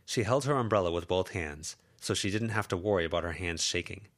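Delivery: plain reading voice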